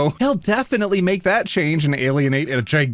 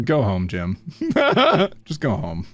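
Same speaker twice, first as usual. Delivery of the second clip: usual speaking voice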